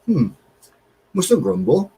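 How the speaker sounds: lightly imitated resigned sigh of a line; a hint of weary old man; retold mild grumble. Retelling Kev's grumble while opening parcel, mildly mocking. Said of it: "And he said".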